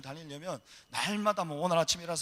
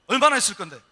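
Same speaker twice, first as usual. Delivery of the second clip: sharp high shout, much louder; 6 semitones higher, 15 dB louder than usual. Retold or imitated. Female officer shouting at the traveller at the border. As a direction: bark the question at him. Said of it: "냅다 소리를 지르면서".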